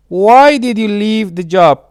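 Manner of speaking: ordinary talk, nothing marked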